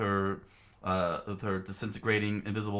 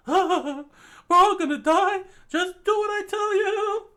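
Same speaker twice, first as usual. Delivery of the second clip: very high, loud, fast-paced plan; high urgent explaining; 11 dB louder than usual. Acted. Andy explaining the plan to Chikara, high loud urgent voice.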